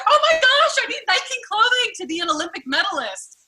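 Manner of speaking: high breathless awe, way up in pitch; lightly mocking starstruck shopper; mock starstruck gush. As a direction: gush like a dazzled fan. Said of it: "You're like".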